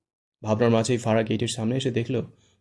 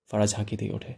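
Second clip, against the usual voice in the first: ordinary talk, nothing marked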